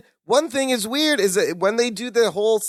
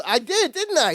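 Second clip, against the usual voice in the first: lightly voiced Larry, higher; a hint of proud Larry, pitched up; imitating Larry; 8 semitones higher than usual. Host quoting Larry after the game, a light higher Larry voice.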